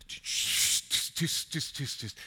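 whispered, urgent shushing; hushing whisper. Retold or imitated. Crowd hushes the blind beggar, whispered.

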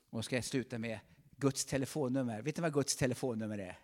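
ordinary talk

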